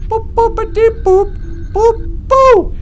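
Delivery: the computer boops back insistently; robot computer beeps. Acted. Computer answers the pilot's complaint with loud high robot boops. Mocking "boopity boop" back.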